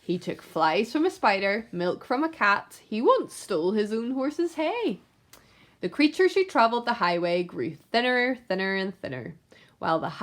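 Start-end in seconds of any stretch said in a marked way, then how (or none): none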